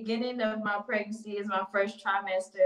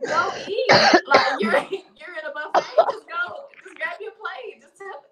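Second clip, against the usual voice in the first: urging coworker; 9 semitones higher, 7 dB louder than usual. Retold or imitated. Coworker urging her, acted out.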